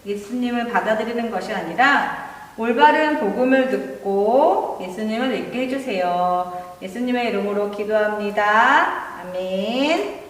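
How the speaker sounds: prayer. Acted. Closing prayer in children's story.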